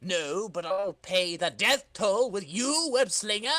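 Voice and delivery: high, loud, slow menace; villain threat. Acted. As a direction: threaten him with relish.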